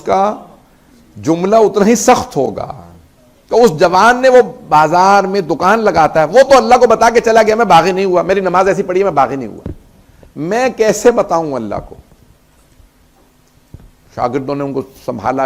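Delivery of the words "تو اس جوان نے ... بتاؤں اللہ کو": loud, higher impassioned cry, slowing into anguish; preaching at full force. Acted. Preacher voicing the weeping scholar to mourners, impassioned cry. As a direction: lament it to the crowd, voice pushed and aching.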